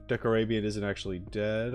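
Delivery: usual speaking voice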